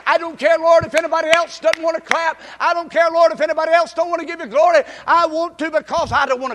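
loud, high preacher's declaration; fervent preacher declaring; preaching at full force. Acted. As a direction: defy the room, full voice.

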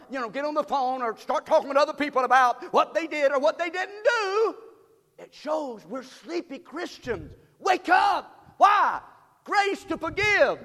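loud, high preaching cadence; full-force preaching. Acted. Pastor rousing the church to wake up, full-force preaching. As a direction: preach it out to the congregation.

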